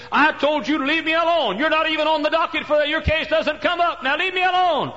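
high, loud fed-up shouting; exasperated yell. Acted. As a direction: drive her off with a yell. Judge yells at the widow.

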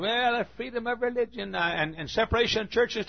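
mocking objector voice. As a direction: imitate the objector, sneering. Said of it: "Somebody said".

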